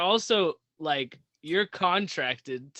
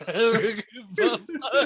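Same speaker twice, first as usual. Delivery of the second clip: laughing; 6 semitones higher than usual. Real, not acted. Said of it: "It's so funny".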